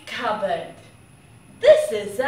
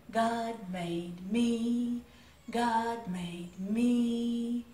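softer, lower, tender line; gentle; 7 semitones lower, 7 dB quieter than usual. Acted. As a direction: say it tenderly to a child. Soft affirmation.

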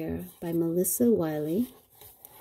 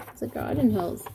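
plain reading voice

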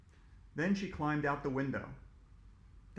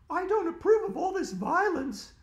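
put-on very high, loud bossy little girl; girl corrects a classmate, high and bossy; snooty know-it-all girl; 14 semitones higher, 7 dB louder than usual. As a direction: squeak it out, correcting him with scorn. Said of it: "said Andrea".

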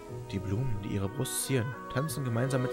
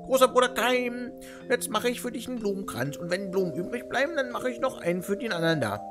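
put-on high, girlish voice of a confused old woman; childlike old woman; 11 semitones higher than usual. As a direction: sing it out like a happy little girl.

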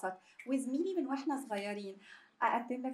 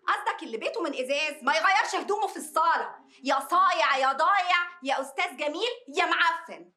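louder, heated string of insults; angry insults; 3 semitones higher, 7 dB louder than usual. Acted. Colleague hurling insults at Gamil, heated and loud. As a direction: fire the insults back at him.

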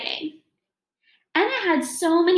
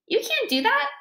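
high mock question; 12 semitones higher than usual. Teacher mimics child doubtfully.

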